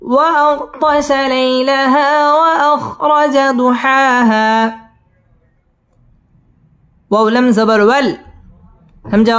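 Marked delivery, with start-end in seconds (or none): 0.0-4.8 slow, melodic tajweed chant; Quran recitation. Shown as a demonstration. Teacher chanting the verse for the student, slow and melodic. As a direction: draw the vowels out in a sung recitation. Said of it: "teacher models the verse".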